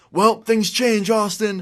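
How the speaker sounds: high, drawn-out holler; acted shout back. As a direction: imitate a friend yelling while running away. Friend shouts back while running off.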